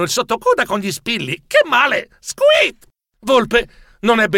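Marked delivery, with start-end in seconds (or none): 1.5-2.9 high yelping mouse squeak; shrill mouse squeal of pain. Acted. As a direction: yelp as the pin jabs. Pricked by pins, squeaks.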